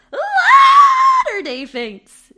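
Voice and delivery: very high, very loud, slow screech; horror scream. Acted. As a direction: shriek the name like a horror trailer. Shouted ad title.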